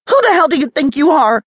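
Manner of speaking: captured woman yelling at her kidnappers. A narrator's reading. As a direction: hurl it at him, fast and loud. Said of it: "she yelled".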